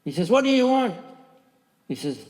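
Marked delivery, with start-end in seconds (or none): 0.3-0.9 high, loud angry demand; acted shout, pitch jumping way up; retold shouting. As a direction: imitate an officer shouting at someone. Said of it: "He started shouting at him".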